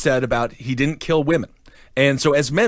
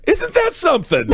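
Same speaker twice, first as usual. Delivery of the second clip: mock amazed. Real, not acted.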